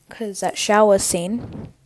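ordinary delivery

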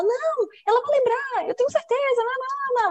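very high, insistent cartoon voice; Alegria. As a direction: play a relentlessly cheerful consoler. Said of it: "a alegria senta do lado e fala".

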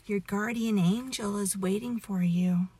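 plain reading voice

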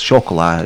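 slow "chocolat" as "sho-ko-LA"; French-style pronunciation. Retold or imitated. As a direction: draw out "chocolat" with a silent T. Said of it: "the movie called Chocolat".

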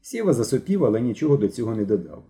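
plain reading voice

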